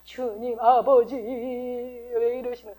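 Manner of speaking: slow, sing-song whimpering prayer, fading soft; whiny prayer. Retold or imitated. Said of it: "예수님이 징징거리는 기도한 거". (느리게 흐느끼듯 징징대는 기도)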